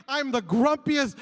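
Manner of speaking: normal talking voice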